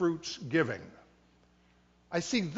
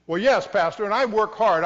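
louder, defensive voice protesting to the pastor; defensive congregant; 3 semitones higher, 9 dB louder than usual. Retold or imitated. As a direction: push back like a proud hard worker. Congregant voiced defending his spending.

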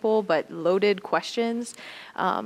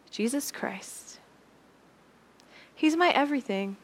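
reverent hush; 6 semitones higher than usual. Retold or imitated. Cece's reverent answer.

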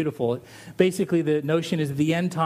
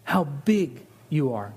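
slow, reverent; slower than usual. Shown as a demonstration. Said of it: "the words literally mean".

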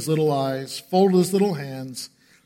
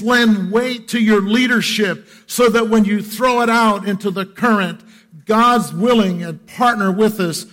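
emphatic exhortation; 6 semitones higher, 7 dB louder than usual. Acted. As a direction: drive each point home from the pulpit. Preacher exhorting dads.

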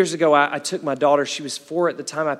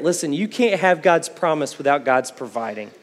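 normal talking voice

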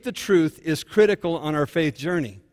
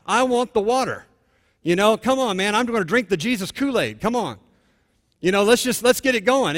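high, raised, impatient enthusiasm; acted eagerness; 6 semitones higher, 4 dB louder than usual. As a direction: play an eager convert hurrying things along. Acting out woman's eager altar-call response.

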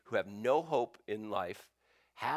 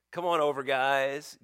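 louder, higher, open-armed call; warm welcome; 5 semitones higher, 7 dB louder than usual. Pretend. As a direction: call them over with joy. Imagined welcoming Jesus.

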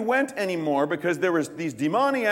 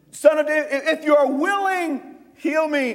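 leper pleading; 7 semitones higher, 3 dB louder than usual. Acted. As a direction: beg for healing with urgency. Leper begging Jesus for healing.

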